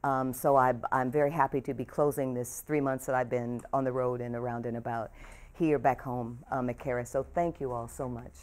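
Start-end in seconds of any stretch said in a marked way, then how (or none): none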